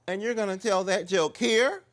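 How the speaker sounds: high and loud disbelief; incredulous drag queen. Retold or imitated. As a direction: challenge him in disbelief.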